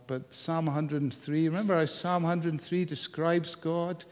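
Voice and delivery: ordinary talk, nothing marked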